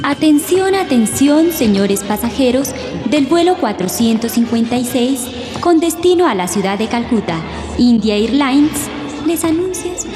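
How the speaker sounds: PA system call, rising atención; airport announcement. Acted. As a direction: loudspeaker flight call.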